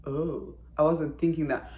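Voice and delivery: soft, low, taken-aback "oh"; imitated stunned reaction. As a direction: play him quietly caught off guard. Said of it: "he was so stunned".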